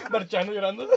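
high laughing cackle; laughing hard. Real, not acted. Mocking his friend who cried while marching, high laughing. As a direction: laugh over the words.

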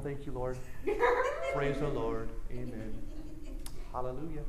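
soft low murmured praise; tidy, hushed churchy praise; mock polite worship. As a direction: mutter praise politely and quietly. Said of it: "nice and neat and culturally acceptable".

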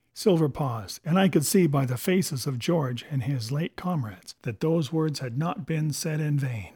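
ordinary delivery, nothing marked

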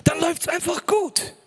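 höher, überschwänglich verstellte Stimme; spöttisch nachgeäfft. Retold or imitated. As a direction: die Wohlstandsprediger übertrieben naiv nachmachen. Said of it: "Das sind Leute, die erzählen mir". (Leute mit Wohlstandstheologie, spöttisch nachgeahmt)

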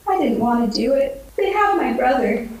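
rising, near tears, pleading; halfling mother close to crying; tearful. Acted. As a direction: voice climbing as she fights tears. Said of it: "Her eyes fill with tears".

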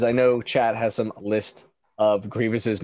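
ordinary talk

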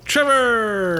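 high, loud, drawn-out shout of a name; excited call. Real, not acted. Co-host recognizing Trevor from the show, excited call. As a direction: holler it long and high.

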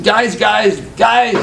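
calling out. Retold or imitated. Calling out to dining hall.

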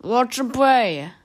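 loud, slow, drawn-out warning; slow declamatory exhortation; solemn proclamation. A narrator's reading. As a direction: proclaim it like a prophet of doom. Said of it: "He raised a solemn hand".

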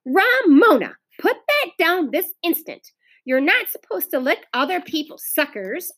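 high, emphatic big-sister scolding; stern scolding. A narrator's reading. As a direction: scold a little sister firmly.

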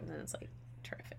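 quick sarcastic whisper. Real, not acted. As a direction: breathe it out as a wry aside. Sarcastic aside about slow release.

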